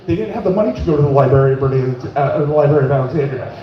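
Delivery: usual speaking voice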